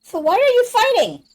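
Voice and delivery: high, loud protesting question; exasperated outburst. Acted. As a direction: cry out in disbelief at the choice. Nash challenges Mia's choice.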